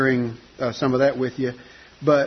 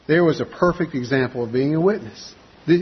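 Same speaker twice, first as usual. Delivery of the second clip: usual speaking voice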